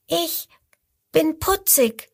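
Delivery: high, bright little croc voice; little crocodile calling. Acted. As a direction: call out proudly in a tiny voice. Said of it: "ruft das fünfte".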